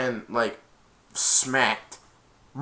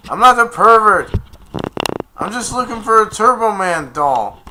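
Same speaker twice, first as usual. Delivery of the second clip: loud, high mock protest; indignant shout; 8 semitones higher, 11 dB louder than usual. Accused man defending himself to attackers, indignant shout. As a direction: protest it at the top of your voice.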